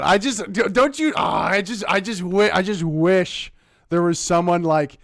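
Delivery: keyed-up, frustrated rant; exasperated outburst. Real, not acted. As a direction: burst out in frustration.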